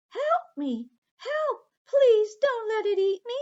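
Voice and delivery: high, loud, panicked cries for help; terrified scream. Acted. Aqua dangling from the seagull's beak, screaming in terror. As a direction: scream as if the bird is carrying you off. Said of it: "Aqua screamed".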